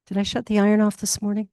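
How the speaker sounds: rushed worried inner voice; fast fretful thought. Retold or imitated. Preacher mimicking a restless mind, rushed and fretful. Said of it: "not think about".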